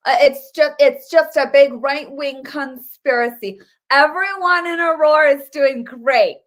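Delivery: mocking the mainstream media. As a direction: sarcastically parrot the news anchors. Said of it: "the mainstream media is like".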